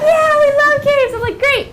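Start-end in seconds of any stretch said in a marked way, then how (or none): 0.0-1.1 high, loud imitation of cheering pupils; excited kids. Pupils answering their teacher, high, loud and excited. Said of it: "They're like". 1.4-1.7 high, pleased teacher exclamation; upbeat teacher. Acted. Teacher replying to an eager class, high and upbeat.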